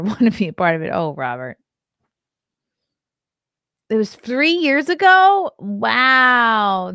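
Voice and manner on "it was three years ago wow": high, slow, loud disbelieving question; can't believe how much time has passed; stunned realization, voice jumping up; shocked disbelief. Real, not acted. Realizes interview was long ago.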